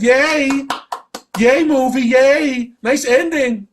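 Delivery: high, loud mock cheering; sarcastic cheer. Vlogger mocking the film's ending, fake cheer. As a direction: cheer as if thrilled, dripping sarcasm.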